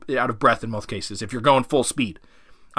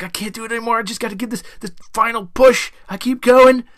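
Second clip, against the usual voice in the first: high strained voice building to a loud push; struggling dog; 7 semitones higher, 4 dB louder than usual. Acted. Dog fighting through its final bite, acted desperate straining. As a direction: play an exhausted dog forcing out one last effort, pitched up. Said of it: "So they're really fighting".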